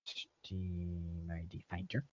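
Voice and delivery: slow whisper to himself. Real, not acted. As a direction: whisper it slowly under your breath. Muttering to himself while searching.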